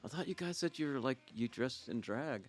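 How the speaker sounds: hesitant drummer. Retold or imitated. Drummer hesitantly questioning band's look.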